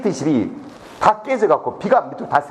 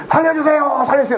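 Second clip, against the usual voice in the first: cry for help; 9 semitones higher, 3 dB louder than usual. Retold or imitated. Official swept away in the river, screaming for help. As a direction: re-enact a desperate yell from the water. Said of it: "살려주라고".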